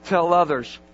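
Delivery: ordinary delivery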